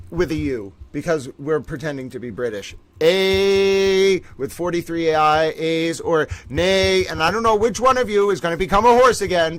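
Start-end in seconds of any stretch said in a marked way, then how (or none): 3.0-7.0 long, slow, drawn-out "A with forty-three I"; drawn-out ayes. Acted. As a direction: stretch every A into a long, slow "A's or nay". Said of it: "I'm gonna put a few extra A's".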